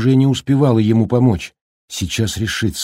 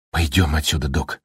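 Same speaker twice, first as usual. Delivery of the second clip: calm; 4 semitones lower than usual. A narrator's reading. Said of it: "спокойствию своего голоса".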